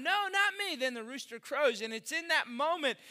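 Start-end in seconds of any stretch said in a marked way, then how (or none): 0.0-0.8 frantic denial. Acted. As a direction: push the accusation away fast. Peter's third denial.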